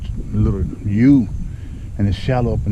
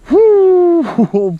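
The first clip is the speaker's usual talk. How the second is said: a high, loud triumphant whoop; excited whoop; 8 dB louder than usual. Real, not acted. Angler whooping as he lands the big bass, loud and high. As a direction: let out a big whoop of victory.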